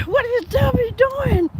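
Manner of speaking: high, slow, laughing repeat of the question; laughing disbelief. Real, not acted. Laughing at the streamer's theatrics, repeating the question.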